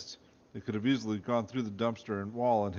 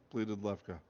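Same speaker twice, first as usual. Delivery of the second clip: ordinary delivery, nothing marked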